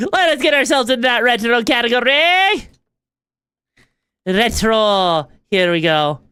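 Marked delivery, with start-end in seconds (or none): none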